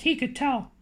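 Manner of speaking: ordinary delivery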